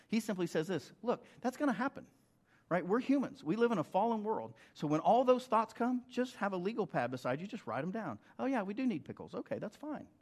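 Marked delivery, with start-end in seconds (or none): 1.0-10.1 higher, chatty aside voice; lively quoted author. Retold or imitated. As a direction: toss it off lightly, much higher than the sermon. Author Richard Foster quoted, lighter voice.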